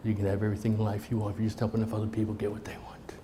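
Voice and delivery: hushed, fast unvoiced whisper of a motto. Real, not acted. Speaker to audience, sharing Zig's quote in a fast whisper. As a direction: breathe the line like a secret, quickly. Quoting Zig in a hush.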